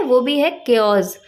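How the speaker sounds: usual speaking voice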